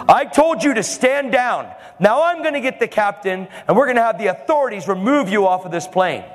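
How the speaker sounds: high, forceful official tirade; angry yelling. Shown as a demonstration. Flight attendant threatens preacher with removal, angry yelling. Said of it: "I'm talking like this".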